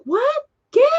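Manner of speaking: high-pitched mock startled question; retold shock. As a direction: imitate a stunned, high-voiced reaction. Retold startled reaction to news.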